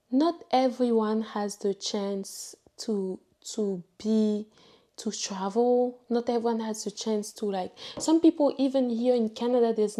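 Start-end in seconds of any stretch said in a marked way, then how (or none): 0.1-6.0 slow, weighty delivery, stress on not; deliberate, heartfelt slow statement; slow emphasis. Real, not acted. Slow emphatic first try. 6.1-7.5 fast, rushed rephrasing; brisk, offhand retake; quick restart. Real, not acted. Quick rephrasing of same line.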